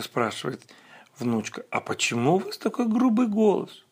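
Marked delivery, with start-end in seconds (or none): 1.7-3.8 put-on much higher, wondering child; little girl voice. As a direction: ask like a puzzled little girl. Girl questions the wolf.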